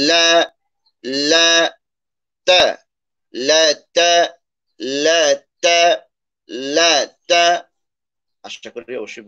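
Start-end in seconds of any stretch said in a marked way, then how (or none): 0.1-7.4 লা and তা as crisp separate recited syllables, higher; la and ta articulation. Shown as a demonstration. Teacher demonstrates both letters, লা and তা raised and separated. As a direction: place the tongue firmly for লা, then তা, each held briefly. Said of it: "লা উচ্চারণটাও দেখি".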